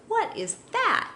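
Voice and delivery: high, alarmed squeak of a question; a puppy spooked by hockey bags; startled dog. Retold or imitated. Owner imitating Iggy spooked by hockey gear, high startled squeak. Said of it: "he freaked".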